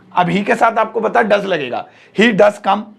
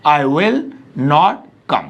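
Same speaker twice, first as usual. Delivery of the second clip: "I will / not come" with a pause inside; broken into parts; 6 semitones lower, much slower than usual. Shown as a demonstration. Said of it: "अब आगे बोलिए".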